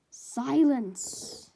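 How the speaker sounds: loud, firm call for silence; commanding order. Acted. All For One silences the villains, commanding. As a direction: shut the room up.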